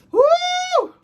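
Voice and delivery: sudden high shouted woo; high whoop. Real, not acted. Recalling the breakup pain, a sudden high shouted woo. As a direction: let out a loud high yelp.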